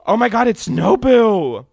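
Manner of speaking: high, loud shocked cry; shocked excitement. Real, not acted. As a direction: shout it in disbelief. Hears the answer is Nobu.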